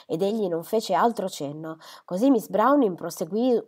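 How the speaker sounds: ordinary delivery, nothing marked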